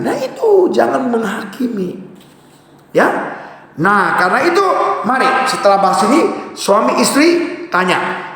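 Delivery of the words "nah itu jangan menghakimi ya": normal talking voice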